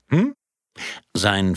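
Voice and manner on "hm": projectionist asking the room. Acted. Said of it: "fragte er in die Runde".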